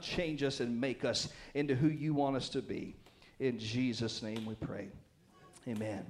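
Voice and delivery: hushed pastor's prayer. Acted. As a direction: pray softly to God.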